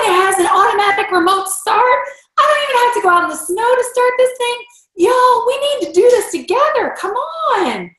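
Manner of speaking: rally your friends to jump in with you; excited invitation. Retold or imitated. Inviting her team to share the Lexus, gushing excited voice. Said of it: "'cause I'm saying".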